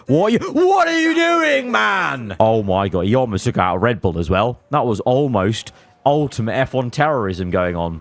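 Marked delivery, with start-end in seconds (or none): 0.1-2.3 high, incredulous yell at the driver; can't believe what he's watching; exasperated. Real, not acted. Player scolding his driver Joe after the crash, exasperated yell.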